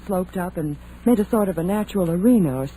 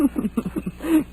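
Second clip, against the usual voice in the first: laugh; 7 semitones higher, 3 dB quieter than usual. Acted. Friend's joke amuses her.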